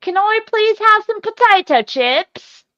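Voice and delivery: high, loud fake Cockney; terrible Cockney accent. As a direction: squeal it out in a badly faked Cockney accent. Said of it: "terrible Cockney accent too".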